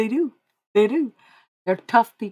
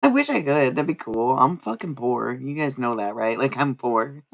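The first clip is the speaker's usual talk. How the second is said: ordinary talk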